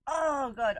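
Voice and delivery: exasperated groan. Real, not acted. Dreading the dash.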